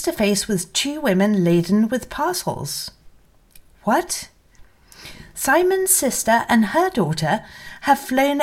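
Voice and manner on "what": sudden high startled question; quick bewildered exclamation; surprised what. A narrator's reading. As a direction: jolt up in pitch, caught off guard. Narrator's sudden surprise at intruders.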